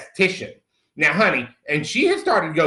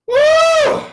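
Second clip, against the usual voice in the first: high whoop thrown out loud; excited whoop; 8 dB louder than usual. Retold or imitated. At the gym doing burpees, an excited high whoop. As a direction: whoop like the workout is a party.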